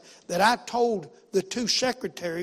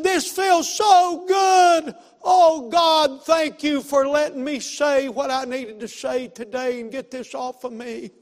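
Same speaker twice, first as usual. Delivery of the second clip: high, drawn-out shout of relief, gradually easing down; exultant preacher's shout, settling toward the end; retold joyful cry; 7 semitones higher, 10 dB louder, slower than usual. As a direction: re-enact a man hollering thanks to God. Retold joyful release after prayer.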